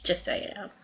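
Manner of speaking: whispered aside. Real, not acted.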